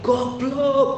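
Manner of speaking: retold yell. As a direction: imitate an angry insult from the stage-side. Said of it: "langsung teriak". (tiruan teriakan makian bernada tinggi)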